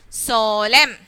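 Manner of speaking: slow "solemn" read as sol-em; silent N. Shown as a demonstration. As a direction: finish "solemn" on the M, dropping the N. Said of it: "not able to pronounce the letter N".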